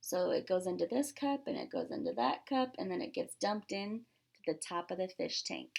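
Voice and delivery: ordinary delivery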